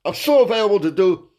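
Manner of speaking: normal talking voice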